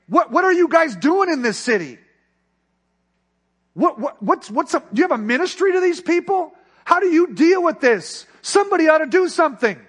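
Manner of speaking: high, loud exasperated rant; retold complaint. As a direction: demand answers, voice climbing. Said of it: "complaining to him".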